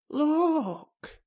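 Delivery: high, urgent half-whisper; excitedly hushed, pitched right up. A narrator's reading. Colin pointing at the wall, excited whisper. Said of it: "he whispered excitedly".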